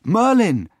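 high, loud call across the hilltop. Acted. The boy calls out to Merlin on the hill, loud. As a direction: call his name to catch his attention. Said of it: "I called out".

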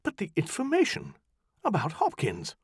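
high, puzzled questioning; lost and confused; high bewildered query. Acted. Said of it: "She was genuinely bewildered".

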